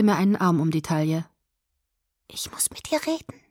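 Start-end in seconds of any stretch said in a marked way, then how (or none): 2.3-3.4 whispered close into the ear; breathy secret whisper, high; right in your ear. A narrator's reading. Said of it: "flüsterte sie mir ins Ohr".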